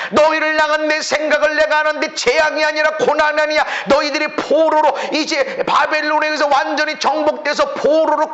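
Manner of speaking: high, ringing preacher's proclamation; full-force preaching. Acted. Pastor rousing the congregation, high full-force preaching. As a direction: lift the room with a new beginning.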